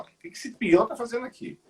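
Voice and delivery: agudo e apressado, estranhando; julgamento encenado. Acted. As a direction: imite alguém julgando, com voz mais aguda. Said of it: "o pessoal primeiro vai te julgar". (tom de quem julga)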